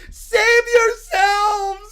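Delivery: very slow, loud, drawn-out cry; hammed-up doom wail; dramatic shout. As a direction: howl it to the whole arena. Child screams warning to crowd.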